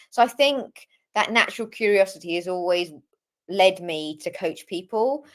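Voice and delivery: normal talking voice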